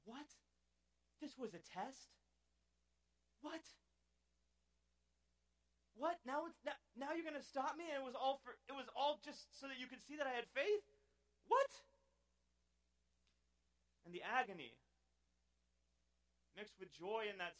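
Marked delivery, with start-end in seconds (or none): 0.0-11.8 high, loud disbelieving shout; rising outrage, building to a yelp; incredulous outburst. Acted. Preacher voicing his baffled outrage at God's test, high and loud.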